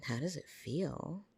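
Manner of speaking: ordinary delivery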